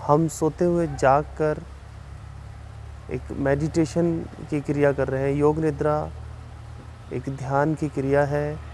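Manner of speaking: slow, measured relaxation guidance with long pauses; unhurried yoga nidra instructor, slightly softened; guided meditation. Real, not acted. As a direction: guide them calmly through breathing, drawing out counts and cues. Leads a yoga nidra session.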